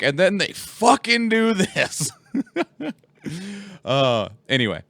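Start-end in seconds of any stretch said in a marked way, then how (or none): 0.0-2.1 higher, slow mock quote; mock swearing voice. Host imitating friends swearing on the stream, slowly. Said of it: "it's just like". 2.3-2.9 a high burst of laughter; cracking up high. Real, not acted. Host laughing at own impression, high.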